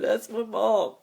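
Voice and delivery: high, tear-choked voice with a laugh caught in it; proud of his mom, sobbing it out; crying. Real, not acted. Quoting himself about his mom, crying proudly. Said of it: "I'm sorry about the crying".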